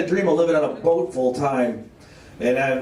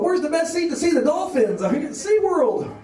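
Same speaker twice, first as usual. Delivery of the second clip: ditzy lady tourist; 12 semitones higher than usual. Retold or imitated. Dim lady tourist quoted.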